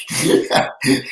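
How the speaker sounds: a short, real burst of breathy laughter; laughing out loud at the memory. Real, not acted. Recalls a funny moment.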